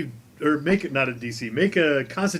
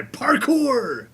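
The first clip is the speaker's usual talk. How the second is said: high, drawn-out mock war cry; barbarian battle cry; 10 semitones higher than usual. Game master voices Roscoe charging over the table, battle cry. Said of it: "Roscoe shouts his barbarian battle cry".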